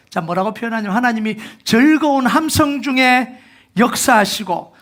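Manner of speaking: high, loud preaching that builds to a cry; fiery preaching. Acted. Pastor rousing the congregation, high loud preaching. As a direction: preach it out, rising, to stir the church.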